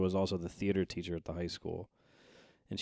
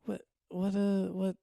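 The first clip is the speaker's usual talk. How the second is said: mock confused dad; 13 semitones higher, 3 dB louder than usual. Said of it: "he just goes".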